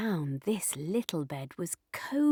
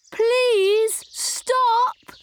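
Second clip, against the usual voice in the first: high, loud call after someone; raised, urgent storybook shout; 13 semitones higher, 6 dB louder than usual. A narrator's reading. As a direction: call out pleadingly to a runaway. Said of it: "called the bears".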